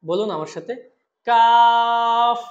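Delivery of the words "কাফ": কাফ loud, long, ordinary light k; four-alif stretch. Shown as a demonstration. Teacher models for repetition, কাফ drawn out. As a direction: hold the vowel of কাফ very long and level. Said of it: "এটাও চার আলিফ টান হবে".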